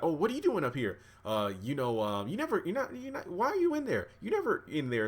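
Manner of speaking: high, stammering mock surprise; retold surprised questioning. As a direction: act out catching someone where they shouldn't be. Retold housekeeper catches man in room.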